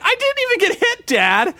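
put-on high, fast whine of a fed-up kid; a six-year-old protesting to his dad, high and fast; exasperated kid. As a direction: squeak it out like a kid who never got touched. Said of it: "And I'm like".

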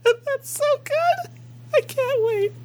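high, squeaky words through laughter; laughing. Real, not acted. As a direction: squeeze it out while cracking up. Speaking through helpless laughter.

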